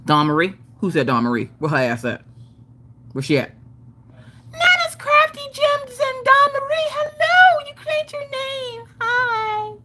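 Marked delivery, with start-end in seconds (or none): 4.5-9.7 extremely high cartoon greeting; put-on squeaky voice. Streamer greeting viewers who claimed a name, squeaky voice. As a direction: squeal hello in a silly falsetto.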